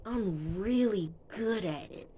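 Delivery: slow, drawn-out "really good"; mimicked line with lingering stress on "really good"; imitated boast. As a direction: stretch out "really good" like an acted brag. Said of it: "The way he said it".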